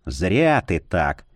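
slow, low reproach; reproachful. A narrator's reading. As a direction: shake your head at him, slowly. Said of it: "укоризненно покачал головой".

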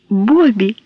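ordinary delivery, nothing marked

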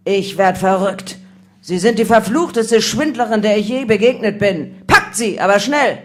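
loud, outraged roar; thundering. A narrator's reading. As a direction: thunder in disbelief, then bark the arrest order. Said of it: "Dann donnerte der Captain".

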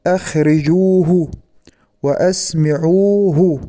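slow, syllable by syllable: أخرجوه وأسمعوه; careful slow demo. Shown as a demonstration. Teacher modelling verbs for students, slow and clear. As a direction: spell out أخرجوه and أسمعوه slowly. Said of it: "كما تقول".

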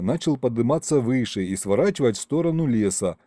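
plain reading voice

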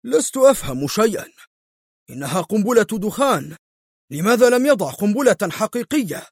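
high-pitched baffled exclamation; puzzled, incredulous outburst; astonished. A narrator's reading. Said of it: "فهتف برنارد في دهشة".

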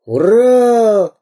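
громкий высокий крик; голоси «ура!»; голосит. Acted. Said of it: "голосил пират". (пират громко голосит «ура!»)

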